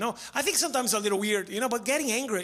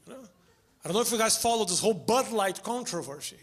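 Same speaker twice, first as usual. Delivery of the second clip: ordinary talk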